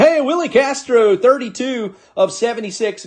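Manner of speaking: high, bright announcement of a good pull; excited callout. Real, not acted. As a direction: call the card out like a prize. Nice numbered card pulled.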